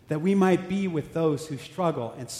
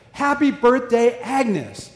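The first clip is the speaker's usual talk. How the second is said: loud, slow, higher birthday greeting; surprise cheer; 7 semitones higher, 5 dB louder, much slower than usual. Retold or imitated. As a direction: call it out to welcome her. Crowd shouts surprise greeting.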